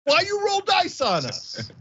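高声假装委屈抱怨; mock whiner. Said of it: "it's like saying".

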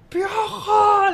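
high, hard shout of the nickname; mock stadium yell; imitated fan shout. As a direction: yell it across the stands. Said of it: "le grita".

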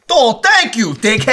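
high, loud Jersey holler; mock Jersey yelling; Jersey accent. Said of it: "Like, get the accent back".

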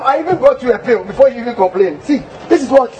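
usual speaking voice

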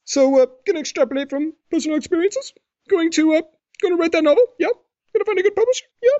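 very high-pitched, needling mock questions; Stewie Griffin impression. Stewie asking Brian about his book, high mocking voice. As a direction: tease in a squeaky voice that goes up and down. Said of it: "voice goes up and down a lot".

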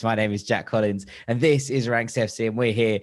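ordinary talk, nothing marked

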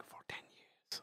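whispered fine print; quiet whisper. Retold or imitated. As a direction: drop to a very soft whisper. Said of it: "some very quietly whispered".

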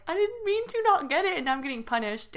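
high-pitched wail of a wronged kid, acted; retold childish protest. Retells protesting punishment as child.

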